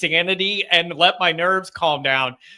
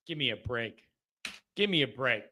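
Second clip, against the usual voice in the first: low, quiet scoff after the hype; deflated dismissal; 8 semitones lower, 11 dB quieter than usual. Real, not acted. Host dismissing receiver hype to the fan, deflated dismissal. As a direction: drop low and soft, unimpressed.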